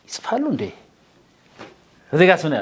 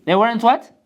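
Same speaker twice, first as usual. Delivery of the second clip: ordinary talk, nothing marked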